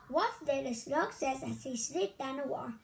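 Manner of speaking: usual speaking voice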